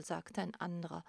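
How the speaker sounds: usual speaking voice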